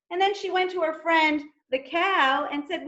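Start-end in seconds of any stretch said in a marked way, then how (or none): none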